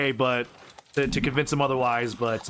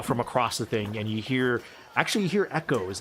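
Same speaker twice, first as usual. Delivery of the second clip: ordinary talk, nothing marked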